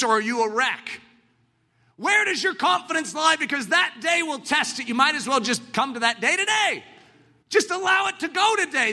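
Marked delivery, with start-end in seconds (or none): 2.0-8.9 high, urgent sermon shout; preaching at full force. Acted. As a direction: press the crowd to decide.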